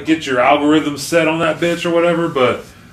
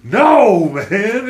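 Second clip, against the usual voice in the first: mock protest; 8 semitones higher, 4 dB louder, much slower than usual. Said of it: "I'm like".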